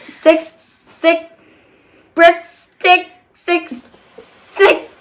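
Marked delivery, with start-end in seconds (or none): none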